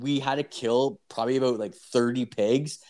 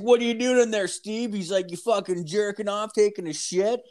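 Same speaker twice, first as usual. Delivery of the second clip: mock coworker; 9 semitones higher than usual. Coworker calling into the stall.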